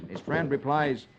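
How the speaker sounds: usual speaking voice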